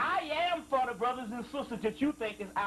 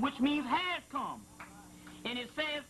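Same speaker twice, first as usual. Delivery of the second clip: ordinary talk, nothing marked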